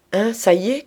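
high, loud exclamation; crying out. A narrator's reading. Aunt greeting her bedraggled niece, crying out. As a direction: call it out sharply, voice jumping up. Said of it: "cria-t-elle".

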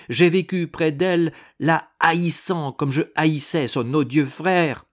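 heated avowal of hate; vehement hatred. A narrator's reading. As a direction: pour out loathing with force. Confesses hatred of his mistress.

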